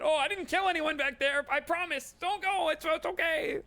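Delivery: a jittery shop lady begging you to stop; panicked shopkeeper. Acted. Shopkeeper nervously denies.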